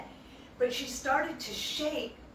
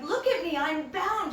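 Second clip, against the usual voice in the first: higher, louder mock grievance; mock complaint; 6 semitones higher, 4 dB louder than usual. Madhumangal showing Krishna his bound hands, mock-aggrieved. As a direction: play up being tied up. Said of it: "he's like".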